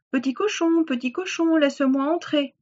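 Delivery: high-pitched coaxing wolf call. Acted. Wolf asking to be let in, high coaxing call. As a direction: coax the pig high to open up. Wolf calls at second pig's door.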